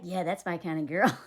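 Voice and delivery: deep, lazy mock dude voice; low dude voice. Friend's creepy boss, a low mock dude voice. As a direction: play the sleazy guy hitting on her, low. Guy voiced in movie recap.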